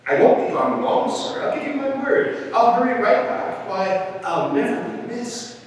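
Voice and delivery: high, eager pleading promises; begging bird. A narrator's reading. Bird promises elephant to hurry back, begging. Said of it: "begged the bird".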